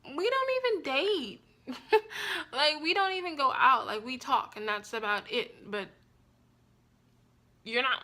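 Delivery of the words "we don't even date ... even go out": high, disbelieving, laughing through it; incredulous mock reply. Telling the boyfriend they never dated, high and incredulous. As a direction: act like his claim is absurd.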